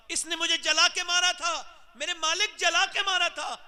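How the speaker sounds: high loud lament, crying out to God; wailing accusation. Acted. Mother accusing her son on Judgment Day, high wailing shout. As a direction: cry it out in pain to the Lord.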